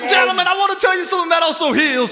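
preacher. Acted. Mock preacher praising ice cream.

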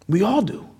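ordinary delivery

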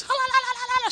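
a quick high chant of nonsense syllables; imitating speaking in tongues. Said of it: "starts speaking in tongues".

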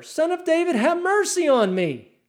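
high, insistent shout for mercy; acted beggar's cry, pitched high and loud. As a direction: yell it over those silencing you. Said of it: "he cries out even louder".

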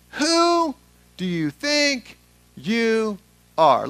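high, loud, drawn-out challenge; indignant challenge. Acted. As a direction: stretch each word into a confrontation. Crowd challenges Jesus in scripture reading.

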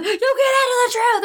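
very high Jack Nicholson-style rant; hammed-up screaming, half amused; mock scream. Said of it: "he's screaming at her".

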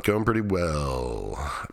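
very slow, drawn out, like thinking it over; slow musing echo. Real, not acted. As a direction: stretch "Going pretty well" out slowly, half to yourself. Slow, musing echo of his line.

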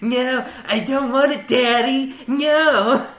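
high, slow, giggling mock whimper; hammed-up pleading with laughter; mock whining victim. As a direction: play a helpless victim for laughs. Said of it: "I was like".

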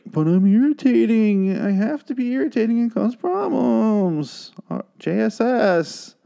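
high, slow, whiny mock teen voice; mocking Enid. Recapper mocking Enid as an irritating teen, high and whiny. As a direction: parody her as a whiny brat. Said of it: "And Enid's like".

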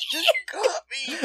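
very high, hammed-up wail; mock caught-out cry. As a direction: squeal a fake tearful confession. Mock confession of drinking worms.

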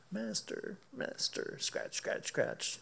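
whispered, pleading master master; whispered plea. Retold or imitated. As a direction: hush it like a pleading pet. Imitating dog pawing in prayer.

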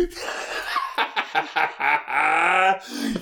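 long high cackle of glee; real gleeful cackling; triumphant laugh. Real, not acted. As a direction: burst into a long, rising laugh. Celebrates being proven right.

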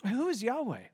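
a sneering question; scornful Pharaoh. Acted. Preacher echoes Pharaoh's question.

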